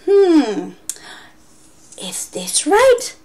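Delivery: high, slow whispered question; whispered teacher tease; teasing whisper. Shown as a demonstration. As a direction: coax the kids playfully. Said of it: "I would say".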